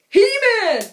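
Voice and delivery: high, loud He-Man yell; imitating a kid yelling He-Man; mock battle cry. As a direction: shout it like a crazed kid. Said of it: "walks around going".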